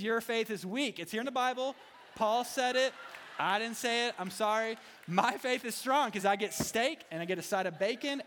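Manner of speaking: loud, high-pitched comic boasting; mock gloating. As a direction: crow triumphantly as a joke. Mock gloating over meat versus kale.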